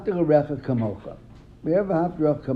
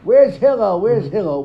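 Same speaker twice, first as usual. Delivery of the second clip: loud, higher calls for Hillel; yelling for Hillel; 6 semitones higher, 11 dB louder than usual. Retold or imitated. Rude man yelling for Hillel at the shower, loud. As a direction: shout for him across the house. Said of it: "starts yelling".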